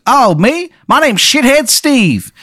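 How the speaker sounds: very high, drawn-out Maryland caricature; terrible Maryland accent. Acted. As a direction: introduce yourself in an absurdly high, bad Maryland accent. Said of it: "this guy's terrible Maryland accent".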